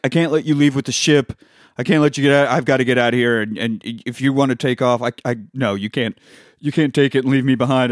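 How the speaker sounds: desperate pleading. Retold or imitated. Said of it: "He's like".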